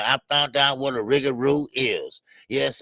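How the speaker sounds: ordinary delivery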